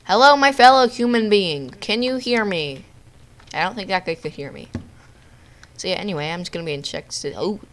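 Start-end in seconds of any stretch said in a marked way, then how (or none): 0.0-2.8 calling out. Pretend. Calling out to other players.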